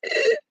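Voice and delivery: sharp, high gasp for breath; gasp for air. Retold or imitated. Reliving choking in her sleep, a sharp gasp. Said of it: "but it was just like".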